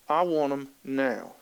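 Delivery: put-on a slow, soft, pouty little-girl voice; low, drawn-out sulky demand. Little girl sulking at her mom in Walmart, slow pouty voice.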